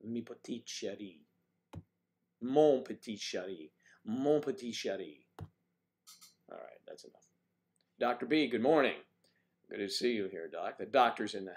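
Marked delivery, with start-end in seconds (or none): 0.1-5.2 playful put-on French accent; lightly hammed French endearment; mock French accent. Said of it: "French is a lovely accent". 5.4-7.3 hushed self-scolding whisper; whispered aside. Real, not acted. Stops own French act.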